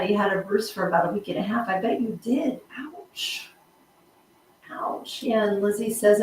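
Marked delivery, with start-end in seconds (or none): 2.8-3.5 breathy whispered ouch, rising. Real, not acted. Sympathy for a week-long bruise. 4.7-5.2 soft unvoiced ouch, falling; whispered ouch. Real, not acted. Sympathy for a week-long bruise.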